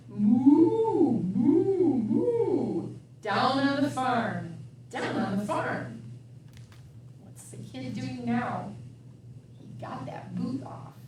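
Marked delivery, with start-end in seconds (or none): 0.1-2.9 louder lowing moo; cow moo. Acted. Reading the farm book, mooing like a cow. 7.2-10.9 whisper. Real, not acted. Showing the child the picture in a whisper.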